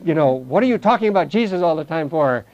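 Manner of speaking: hammed-up annoyed brush-off; dismissive heckling. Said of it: "what do we get? You know, people".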